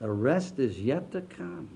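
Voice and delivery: soft, slow echo of the line; quiet. Shown as a demonstration. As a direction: let it settle softly and slowly. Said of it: "Bill Weldy gave me that thought".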